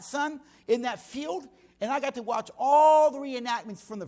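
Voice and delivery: high, loud, driving preacher's voice; preaching at full force. Acted. Pastor telling the congregation about the reenactment, preaching loud and high. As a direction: preach it out with big energy. Energetic preaching storytelling opening.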